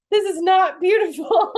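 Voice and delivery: high, slow laughing protest; laughing disbelief. Retold or imitated. Mother in labour laughing at being filmed, high and slow.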